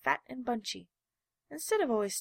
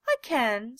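proud claim; 3 semitones higher, 8 dB louder than usual. A narrator's reading. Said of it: "said the little rabbit".

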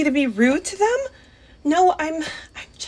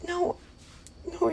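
ordinary talk, nothing marked

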